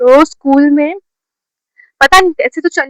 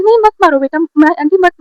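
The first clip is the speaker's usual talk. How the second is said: high-pitched imitated intervention; pleading aunt; 6 semitones higher than usual. Visiting aunt tells the mother to stop hitting, mock pleading. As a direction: mimic an aunt urging restraint.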